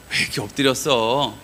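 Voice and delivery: low, tender question; gentle concern. Acted. God consoling Joshua lying face down, low gentle concern. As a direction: ask it soothingly, without reproach. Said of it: "이러는 것 같으세요?".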